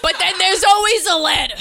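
loud, high-pitched comic squawk; Gilbert Gottfried. Acted. Cheering on after a dog's death, Gilbert Gottfried impression. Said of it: "I sound like Gilbert Gottfried".

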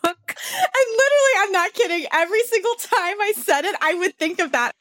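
very high, breaking-up laugh-talk; helpless giggly high voice; laughing while talking. Real, not acted. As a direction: squeeze the words out through laughter. Laughing too hard while confessing.

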